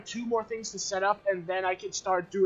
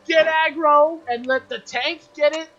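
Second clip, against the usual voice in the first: loud, rising rant at a teammate; indignant scolding; 5 semitones higher, 8 dB louder than usual. Real, not acted. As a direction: scold them loudly for the blunder. Scolding teammate over game blame.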